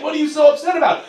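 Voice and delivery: high, fast, light mock girlfriend voice; chirpy and clueless, rattled off quickly; girlfriend puzzled. Girlfriend puzzled at her upset boyfriend, high mock voice. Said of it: "She's like".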